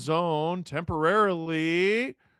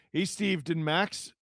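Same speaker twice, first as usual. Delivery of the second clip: ordinary talk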